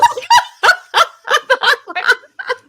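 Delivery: real burst of high, loud laughter. Real, not acted. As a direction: crack up at the line. Laughing at clunky line.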